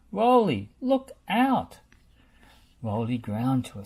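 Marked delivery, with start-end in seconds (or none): 0.1-1.8 shouted warning. A narrator's reading. Bob warns Rolly.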